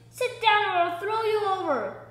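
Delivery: loud, high shouted threat; angry shout. A narrator's reading. Sailor threatening passenger in rocking lifeboat, angry shout. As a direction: yell a furious order.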